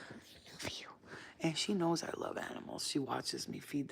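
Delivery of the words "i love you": very soft, breathed affection; tender whisper. Real, not acted. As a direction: whisper lovingly to your pet. To her dog.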